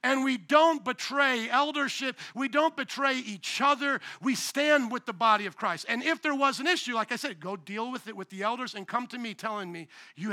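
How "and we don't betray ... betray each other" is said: slow, stress on don't; slow stressed declaration. Real, not acted. Pastor to church, slow firm declaration. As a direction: press each word home. Preaching the rule.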